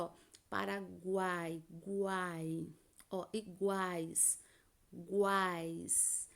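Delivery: Paraguai and guai said slowly, the U soft but heard; soft u glide. Shown as a demonstration. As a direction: gently voice the U in guai, iguais. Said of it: "pronuncia o U de uma forma suave".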